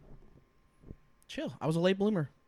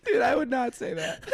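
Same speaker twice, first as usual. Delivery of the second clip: ordinary talk, nothing marked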